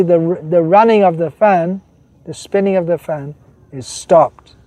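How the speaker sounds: emphatic preacher reading the guru's words; sermon quote reading. Acted. Preacher reading a quote to the congregation, emphatic. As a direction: read the master's words with weight.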